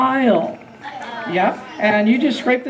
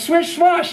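higher swash after swish; brush sound; 5 semitones higher than usual. Shown as a demonstration. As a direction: sweep it like a paintbrush. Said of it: "this goes".

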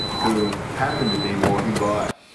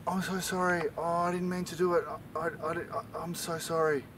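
small, high, soft pleading voice; whimpering apology; 8 semitones higher, 8 dB quieter, faster than usual. Acted. Uncle Barry apologising to the policeman, high soft whimper. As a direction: stammer it softly, pitched up.

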